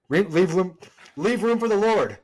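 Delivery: high, loud prim chaperone; hammed-up chaperone scolding; mock chaperone scold. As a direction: play the strict chaperone at the dance.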